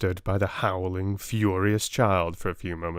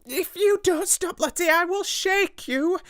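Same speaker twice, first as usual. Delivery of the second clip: much higher, louder threat; threatening Miss Amelia; 3 dB louder than usual. A narrator's reading. Miss Amelia loses patience with Lottie, high shrill threat. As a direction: snap a threat at the child. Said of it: "then in quite another tone".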